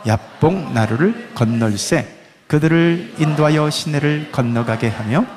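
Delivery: slow, low Bible reading; measured scripture recitation. Acted. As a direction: read the passage solemnly and slowly. Reads scripture aloud.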